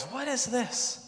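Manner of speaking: mock-bewildered high voice; baffled manager. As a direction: ask it high-pitched, acting baffled. Manager puzzled by the pink thing.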